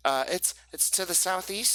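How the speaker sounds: put-on high, loud, excited kid voice; high-pitched child passing on a secret; eager young boy Pip. Game master plays Pip, a boy.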